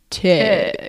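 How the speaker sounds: lightly voiced announcer calling a name; race announcer. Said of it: "the announcer announcing".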